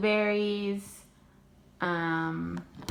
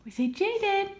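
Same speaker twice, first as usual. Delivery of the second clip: high coaxing; 10 semitones higher than usual. Real, not acted. Mom coaxing her toddler to say a name, high baby talk.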